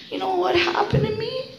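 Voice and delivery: very high, self-pitying whine; professional victim. Acted. Recovering addict starting a victim's tale, mock self-pity. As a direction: whine it upward, playing the victim. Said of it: "My professional victim".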